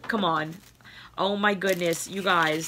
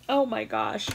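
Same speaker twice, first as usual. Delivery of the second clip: ordinary talk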